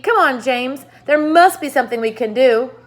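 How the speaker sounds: high, loud urgent pushing; urging. Acted. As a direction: press James hard to think. Centipede urges James.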